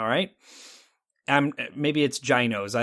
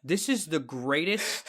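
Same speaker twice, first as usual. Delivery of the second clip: slow, softer, dumbfounded delivery; stunned awe; 6 dB quieter, slower than usual. Retold or imitated. Man after his first bite of cheesesteak, stunned slow awe. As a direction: let each word out slowly, as if stunned. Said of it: "I just looked out with a blank stare".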